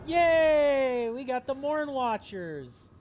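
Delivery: delighted to see friends join; excited cheering. Real, not acted. Streamer cheers at new allies.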